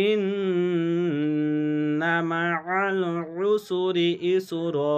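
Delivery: slow melodic chant with long ghunna on ইন্না; Quran recitation. Shown as a demonstration. Teacher reciting the whole verse for students. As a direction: recite the verse slowly and tunefully. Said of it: "একটি জবরকে আপনার বাদ দিতে হবে".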